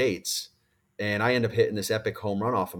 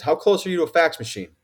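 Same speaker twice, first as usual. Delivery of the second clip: higher, louder and fast, excited agent; urgent question; 6 semitones higher, 5 dB louder, faster than usual. Retold or imitated. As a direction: rush it like there's no time to lose. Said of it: "he goes".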